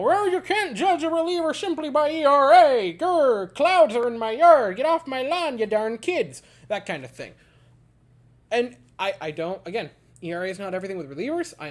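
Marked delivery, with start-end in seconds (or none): 0.0-6.4 put-on higher, louder cranky old-timer growl; grumpy old man. Mocking older talking heads, a hammed-up cranky old man. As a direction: ham up a get-off-my-lawn grouch. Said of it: "older talking heads".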